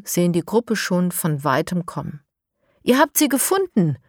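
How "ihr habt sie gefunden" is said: high happy greeting; joyful. Acted. Mo greets the children bringing the piglet back, joyful. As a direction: beam with relief. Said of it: "freut sich Mo".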